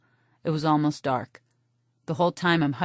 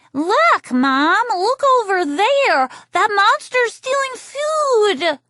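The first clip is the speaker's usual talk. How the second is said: put-on high, loud, slow child calling out; a kid tugging at mom to see; little boy voice; 6 dB louder, much slower than usual. Boy alerts his mom to the monster, high and loud.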